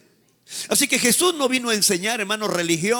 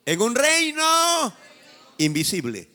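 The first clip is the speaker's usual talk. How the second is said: slow call and response; 3 semitones higher, slower than usual. Shown as a demonstration. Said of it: "Repite conmigo".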